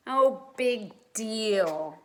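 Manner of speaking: slow, drawn-out unimpressed sigh of words; bored. Acted. Peter brushing off the game, slow and bored. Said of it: "who gave a bored yawn".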